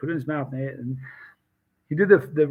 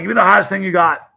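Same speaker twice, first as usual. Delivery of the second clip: loud, higher brash customer voice; cocky demand; 5 semitones higher, 10 dB louder than usual. Retold or imitated. Weekend cowboy boasting to the booth owner, loud and brash. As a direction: bark it like a boastful tough guy.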